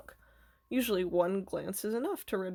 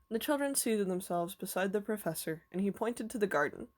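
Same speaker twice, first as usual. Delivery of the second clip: plain reading voice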